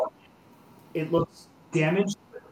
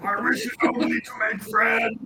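lonely monster voice; 6 semitones higher, 6 dB louder than usual. Acted.

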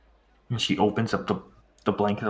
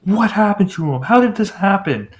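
higher, louder furious demands for his son; angry shouting father; 7 semitones higher, 9 dB louder than usual. Acted. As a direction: shout it at the guards, a father who won't be calmed. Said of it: "And he's like".

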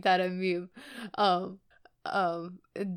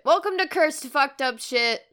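announcer intro; 6 semitones higher, 6 dB louder than usual. Acted. Mock spooky show intro.

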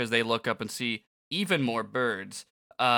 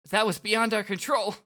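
protesting; 9 semitones higher than usual. Acted. Voicing Nagi protesting the ruling.

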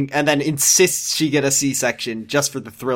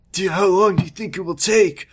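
nervous; 3 semitones higher than usual. Acted.

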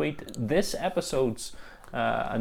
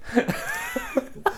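a high giggle; a real high-pitched giggle; high laugh; 3 dB quieter than usual. Real, not acted. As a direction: giggle at the teasing. Laughing at segue.

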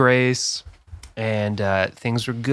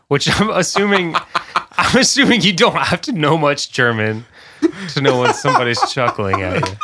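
amused, louder, higher delivery through laughter; laughing while talking; 4 semitones higher, 4 dB louder than usual. Real, not acted. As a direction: talk through real chuckles. Laughing at his own read-out.

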